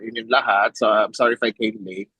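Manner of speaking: mixing Tagalog and English; Taglish. Real, not acted. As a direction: Filipino speaker switching into Tagalog. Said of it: "I'm gonna do in Taglish".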